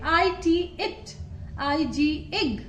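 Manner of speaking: आईटी spelled, then इट, slowly; slow phonics demo; slow letters-to-word. Shown as a demonstration. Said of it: "आई की blending देखिए यहाँ पे".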